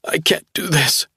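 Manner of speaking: high, tearful broken voice; sobbing. A narrator's reading. Young man sobbing to Caroline, high tearful despair. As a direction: choke it out through sobs. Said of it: "still sobbing".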